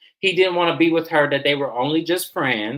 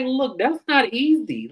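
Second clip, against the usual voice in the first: imitating Stacy; 10 semitones higher, slower than usual. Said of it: "Stacy was like".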